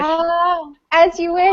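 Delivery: high, delighted swoon. Real, not acted. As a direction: melt at the words. Swooning at the quote.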